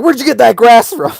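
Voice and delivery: loud, high mock yell; hammed-up outraged questioning; pissed-off mom. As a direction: imitate a furious mom's demand. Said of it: "She was pissed".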